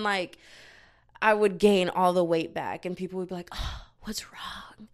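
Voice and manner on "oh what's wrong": slow, whispered mock dismay; imitating hushed, pitying shock; mock concern. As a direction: whisper it like an appalled onlooker. Mimics shocked concerned people.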